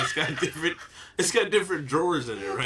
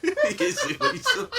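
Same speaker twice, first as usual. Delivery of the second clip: squeaky laugh on every word; barely gets it out laughing; high giggling question; 3 dB louder than usual. Real, not acted. Breaks up while retelling.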